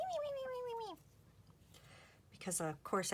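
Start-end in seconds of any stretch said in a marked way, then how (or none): 0.0-1.0 very high chipmunk squeak; sped-up voice. Retold or imitated. Crafter mimics a sped-up video to viewers, chipmunk squeak. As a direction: imitate her own fast-forwarded voice. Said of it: "you're just gonna hear my voice going".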